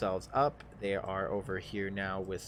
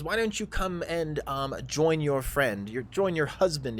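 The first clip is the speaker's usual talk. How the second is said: lightly voiced drow, pitched higher; inviting drow; 5 semitones higher, 3 dB louder than usual. Drow invites the gamer to join, higher and inviting. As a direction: coax a newcomer in a higher voice. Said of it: "He goes".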